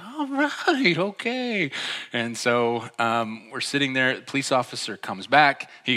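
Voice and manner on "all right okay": very high, exaggerated placating reply; mock backing off. Back-seat friend humoring the ranting driver, mock meek.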